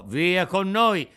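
slow, loud order; firm command. A narrator's reading. The carabinieri cut off Pinocchio's protest, loud. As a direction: cut him off flatly.

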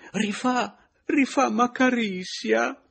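put-on high, loud, slow foreign accent; excited Spanish lady. A narrator's reading. Pepita gushes that the caress happened again. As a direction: gush it like a flustered Spanish woman.

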